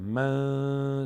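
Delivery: من ending in a held nasal hum; nasal held noon. Shown as a demonstration. Teacher showing where the noon sounds, nasal hum on من. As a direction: let the ن of من ring in the nose. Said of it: "صدا تو بینی داره میاد".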